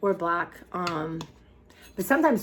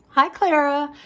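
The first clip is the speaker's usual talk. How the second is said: high, loud sing-song pet greeting; talking to a pet; 9 semitones higher, 8 dB louder, slower than usual. Real, not acted. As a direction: greet the cat brightly. Greeting her cat.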